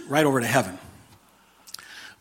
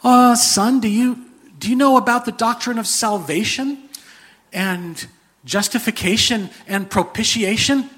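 much higher, louder mock official; a puzzled superior angel questioning the thief; senior angel; 8 semitones higher, 4 dB louder than usual. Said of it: "Gets another angel".

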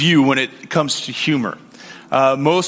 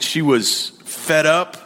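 ordinary talk

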